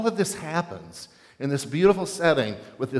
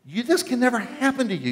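much higher pitched yell, stress on "This"; 9 semitones higher than usual. Shown as a demonstration. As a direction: Peter screaming at Jesus in protest. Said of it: "This is yelling and screaming".

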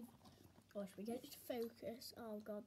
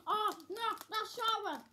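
high loud squeal at sourness; sour shock; 10 semitones higher, 11 dB louder, slower than usual. Real, not acted. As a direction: yelp as the sour hits. Sour candy hits hard.

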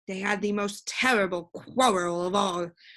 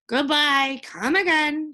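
louder, higher call; shouted farewell; 7 semitones higher, 5 dB louder than usual. A narrator's reading. Witch calling after Milo and Tok as they leave, shouting. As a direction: call out goodbye after departing guests. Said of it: "shouted the witch".

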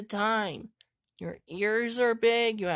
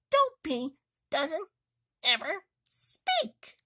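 ordinary delivery

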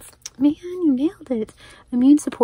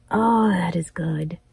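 slow awed whisper; hushed and admiring; 3 semitones lower, slower than usual. Real, not acted. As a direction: breathe it out, impressed. Admiring a gift quietly.

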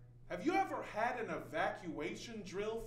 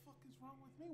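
much softer, higher incredulous echo; 4 semitones higher, 15 dB quieter, much faster than usual. Acted. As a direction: throw the question back under the breath. Throws the question back, incredulous.

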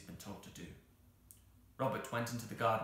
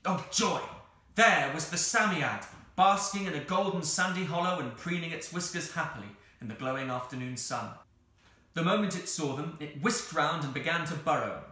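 louder, joyful storytelling; excited narration; 7 semitones higher, 8 dB louder than usual. A narrator's reading. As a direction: burst into gleeful narration.

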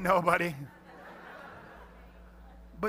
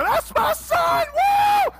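very high, loud, drawn-out scream; screaming proud parent; 11 dB louder, much slower than usual. Retold or imitated. Pastor relives six-year-old son's goal, screaming. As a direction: scream and whoop at your kid's goal. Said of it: "I'm screaming".

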